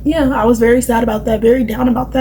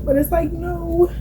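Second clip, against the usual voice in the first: self quoting; 7 semitones higher, 6 dB quieter, slower than usual. Retold or imitated. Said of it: "I was just like".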